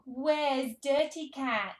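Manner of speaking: playful searching. Pretend. Reader seeking a picture with toddler, slow playful excitement. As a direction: stretch it out like a big hunt.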